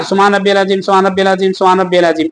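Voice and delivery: quick, clipped-together repeats of सुभान रब्बियल अजीम; rushed repetition. Shown as a demonstration. Teacher mocking the hurried wrong way for students, quick. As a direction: rattle off the phrase carelessly. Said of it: "और ऐसे नहीं पढ़ना".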